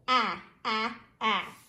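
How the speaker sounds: "Ah, ah, ah" as a short clipped a vowel, like in apple; short a sound. Shown as a demonstration. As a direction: tap out the short a three times. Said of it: "the ah sound, short A".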